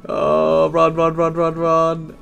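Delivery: panicked urging to flee; being chased. Real, not acted. Fleeing in game chaos.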